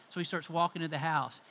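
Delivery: ordinary talk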